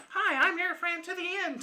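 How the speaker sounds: put-on very high, squeaky childish doll voice; put-on high-pitched robot doll; playful robot toy voice. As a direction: chirp it like a playful talking toy. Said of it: "which was kind of a playful".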